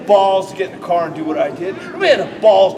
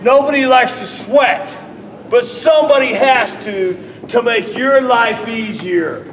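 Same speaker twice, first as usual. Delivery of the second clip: higher, louder and slowed; loud raised take of the line; loud and rising; 3 semitones higher, slower than usual. Shown as a demonstration. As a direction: push it up and out. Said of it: "Put inflection in your voice".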